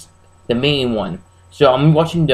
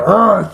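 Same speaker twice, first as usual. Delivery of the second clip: short, louder mock monster roar; raised, drawn-out "arr" noise; imitated film roar; 5 semitones higher, 5 dB louder than usual. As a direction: imitate the creepy growl from a horror film.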